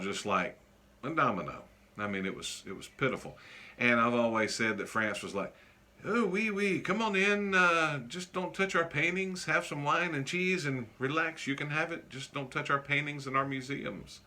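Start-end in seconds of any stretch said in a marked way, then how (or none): 6.0-14.2 higher mock French accent, hammed-up. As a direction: lampoon the French as an easygoing host in a bad French accent. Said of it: "almost came out with a Middle Eastern accent instead of French".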